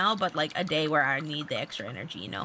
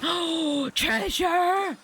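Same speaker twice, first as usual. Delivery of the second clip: high, gasping cry of discovery; excited shout; 10 semitones higher, much slower than usual. Real, not acted. Player spotting treasure in the game, excited. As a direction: squeal it out, last syllable held. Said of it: "I got so excited".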